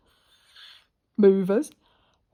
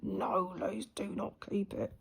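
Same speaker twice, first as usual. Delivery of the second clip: imitating husband; 8 dB quieter, slower than usual. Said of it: "he went".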